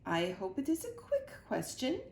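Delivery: impatient queen voice; a royal, slightly impatient reply. A narrator's reading. The Queen hurries Mac's question, impatient and regal. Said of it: "said the queen".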